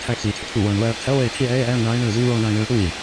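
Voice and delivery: low voice through radio static; pilot over the radio. Acted. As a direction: read back like a pilot on the radio. Pilot radio readback in sim.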